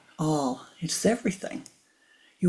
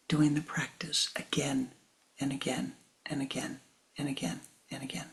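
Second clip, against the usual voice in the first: soft counsel; 2 semitones lower, 7 dB quieter than usual. Retold or imitated. Teacher's counsel, whispered.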